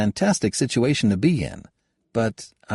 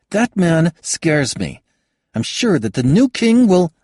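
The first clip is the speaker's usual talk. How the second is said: put-on higher, louder, exasperated young woman; young woman scolding; 11 semitones higher, 6 dB louder than usual. Kayada scolds Halbert for having no opinion, higher and louder. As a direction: cut him down with rising heat.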